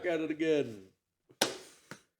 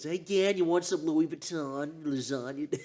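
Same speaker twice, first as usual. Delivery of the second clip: lightly voiced New Jersey accent; a hint of a Jersey girl; 4 semitones higher, 4 dB quieter than usual. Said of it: "I don't know if I could do the Jersey voice".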